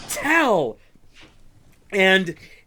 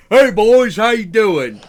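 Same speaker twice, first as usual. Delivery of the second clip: put-on drunken dwarf king; 4 semitones higher, 9 dB louder than usual. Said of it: "be like".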